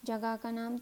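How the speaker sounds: normal talking voice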